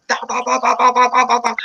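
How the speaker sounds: high rapid "da da da" scat; babbling imitation of someone talking fast; mock fast chatter. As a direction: rattle off nonsense syllables like fast talk. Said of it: "you start like".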